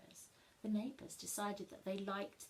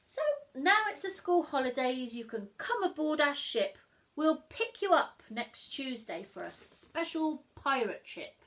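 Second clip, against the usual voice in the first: loud, cheery pirate invitation; cheerful pirate boy; 2 semitones higher, 8 dB louder than usual. Acted. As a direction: call it out like a jolly pirate. Jim's invitation letter.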